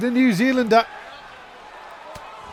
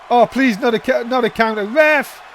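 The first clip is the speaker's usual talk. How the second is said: loud pleading ringside call; excited commentator; 3 semitones higher, 5 dB louder than usual. Real, not acted. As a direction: shout the ending. Count ends in draw.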